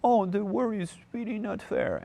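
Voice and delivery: high, far-off whimpering complaint voice; whiny cartoon crybaby. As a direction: whine it like a self-pitying cartoon chick. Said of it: "always crying".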